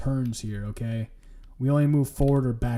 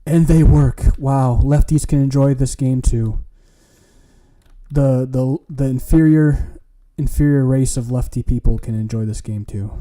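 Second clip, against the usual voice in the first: excited; 8 dB louder than usual. Real, not acted. Keys work, loud joking cheer.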